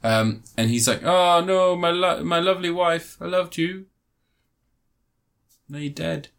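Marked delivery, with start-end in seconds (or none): 1.0-6.3 mock lament. Husband mourning his zombie wife.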